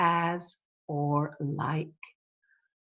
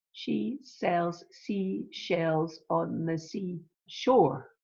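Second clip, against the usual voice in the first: very slow, word by word; sounds picked out; much slower than usual. Shown as a demonstration. Teacher replays the tongue twister for its sounds, slow and separated. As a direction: separate the words to show each sh and s. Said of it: "that's your sounds that you hear there".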